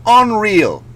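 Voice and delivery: normal talking voice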